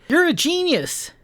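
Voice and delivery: very high-pitched admiration; excited squeal of a compliment; gushing praise. Acted. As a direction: exclaim it, thrilled for a friend. Character praises the friend's work.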